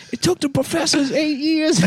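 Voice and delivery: high squeaky excited voice; falsetto amazement. Retold or imitated. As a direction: gush it in falsetto. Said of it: "they're like".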